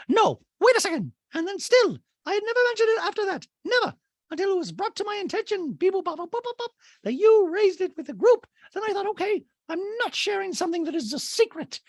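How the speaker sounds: very high-pitched, emphatic protest; mock indignant housewife. Castmate defends herself to Crystal, high-pitched mock protest. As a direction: imitate a castmate arguing in a high voice. Acted quarrel, cast member protesting.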